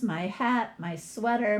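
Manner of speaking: ordinary delivery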